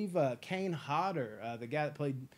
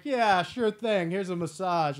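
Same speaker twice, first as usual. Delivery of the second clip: loud, slow, higher sarcastic brush-off; mock dismissive; 4 semitones higher, 8 dB louder, slower than usual. Hospital staff dismissing Seagal, slow loud sarcastic drawl. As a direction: drag it out like you don't care at all. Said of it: "everybody's like".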